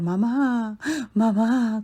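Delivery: put-on high, broken toddler wail; a small child sobbing alone in the hallway; crying child. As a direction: cry for mummy inconsolably. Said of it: "con un desconsuelo tremendo".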